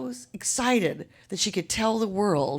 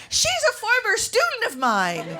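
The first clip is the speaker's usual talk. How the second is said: excited quote; 12 semitones higher than usual. Retold or imitated. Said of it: "and said".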